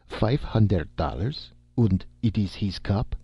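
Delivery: a hint of German, interested; German accent. Stranger asks about the reward, light German accent. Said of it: "queried the stranger".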